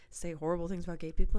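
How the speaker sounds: normal talking voice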